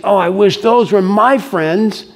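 high, drawn-out wistful wishing; wistful outsider. Acted. Left-out kid watching the in crowd, high and wistful. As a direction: wish aloud, pitched well up and slow. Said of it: "stand on the edge and say".

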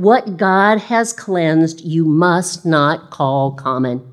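very slow, weighty divine words; slow solemn pronouncement. Acted. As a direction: lay each word down slowly. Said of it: "the voice spoke to him again".

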